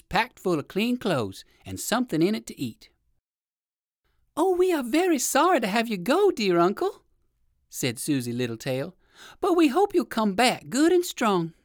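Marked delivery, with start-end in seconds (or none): 4.4-7.0 high-pitched little bunny voice; sweet little rabbit, much higher; rabbit child, sorry. Acted. 9.4-11.5 high little bunny voice; lightly voiced young rabbit wishing uncle well; higher, hopeful child rabbit.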